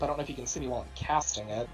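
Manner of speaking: normal talking voice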